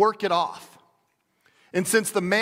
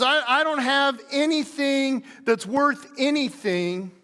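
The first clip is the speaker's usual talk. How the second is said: despairing widow; 6 semitones higher than usual. Retold or imitated. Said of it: "She says".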